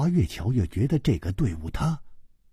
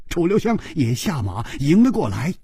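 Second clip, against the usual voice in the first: plain reading voice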